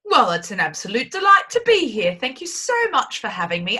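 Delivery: put-on upper-crust English guest; reply in a mock-posh English accent. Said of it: "when I go posh, I have to have an English accent".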